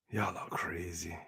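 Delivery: soft unvoiced aside, slow; whispered aside. Real, not acted. Streamer muttering to chat, whispered. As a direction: breathe it to chat.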